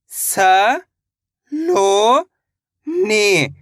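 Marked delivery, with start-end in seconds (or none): none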